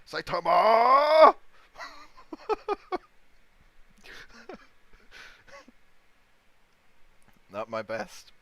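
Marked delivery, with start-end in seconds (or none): 0.1-1.3 "Saitama" yelled in a mock aggressive Japanese accent, dragged out slowly, rising at the end, much higher and louder. Shown as a demonstration. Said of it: "in an aggressive Japanese accent". 1.4-7.5 high wheezing laugh. Real, not acted. Laughing after the bit.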